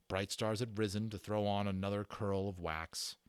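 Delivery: usual speaking voice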